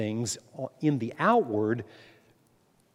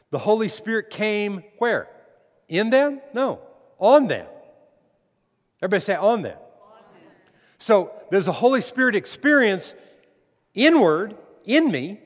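raised, higher-pitched preacher's voice; emphatic preaching; 8 semitones higher, 6 dB louder than usual. Real, not acted. Preacher teaching a congregation that the Spirit comes on them, emphatic. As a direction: drive it home loudly, key words punched.

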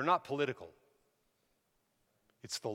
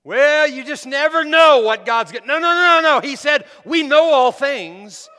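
full-force preaching; 9 semitones higher, 18 dB louder than usual. Real, not acted. Preacher answering doubters in the congregation, loud and emphatic. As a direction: shout down the doubts with conviction.

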